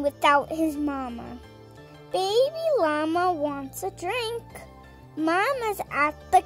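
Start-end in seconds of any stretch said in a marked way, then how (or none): none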